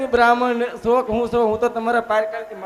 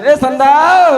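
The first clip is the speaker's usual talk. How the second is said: drawn-out raised summons; calling out; 2 semitones higher, 10 dB louder, much slower than usual. Acted. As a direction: holler for someone out of sight. Calling out for absent girl.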